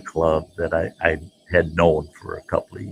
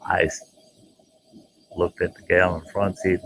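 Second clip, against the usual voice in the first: ordinary talk, nothing marked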